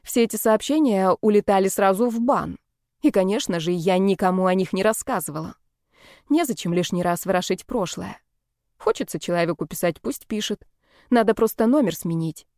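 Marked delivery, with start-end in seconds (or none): none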